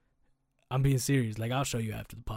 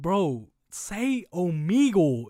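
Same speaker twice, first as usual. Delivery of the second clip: "say Omegle" said slowly and louder; loud, slow insistence; 6 semitones higher, 9 dB louder, much slower than usual. Retold or imitated. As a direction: demand he say it right. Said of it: "I was like".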